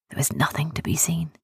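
plain reading voice

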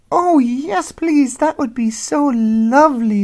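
high, tiny mouse voice; little voice. Acted. Mouse answering the old dog, tiny and high. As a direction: squeak it out small.

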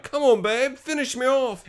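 high, put-on lover's voice; hammed-up come-on, making fun of it; mocking re-enactment. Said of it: "kinda mocked her".

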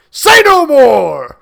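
furious, loud, slow roar; roaring king. Acted. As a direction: roar to silence him. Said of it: "roared the king".

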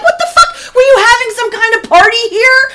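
high-pitched indignant demand; incredulous confrontation. Retold or imitated. Mother confronting son about a party, retold indignation. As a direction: demand an explanation, voice shooting up.

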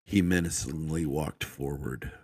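usual speaking voice

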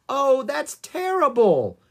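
high, loud, drawn-out dismay; appalled. Acted. Annie hears from rangers that poachers kill rhinos, appalled.

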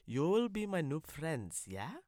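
ordinary talk

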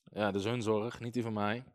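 ordinary talk

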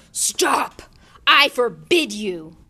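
very loud, desperate command; panicked yell. A narrator's reading. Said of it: "yelled Uncle Vernon in panic".

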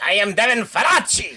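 hammed-up theatrical name reveal; Devin Faraci. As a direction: announce yourself like a caped villain. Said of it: "He sounds like he's a".

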